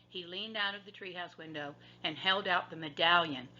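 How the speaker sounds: plain reading voice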